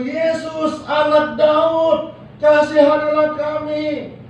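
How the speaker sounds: high, slow, drawn-out appeal; acted cry, held on a high pitch; pleading shout. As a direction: call out for mercy, stretching each phrase. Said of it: "Mereka teriak lagi".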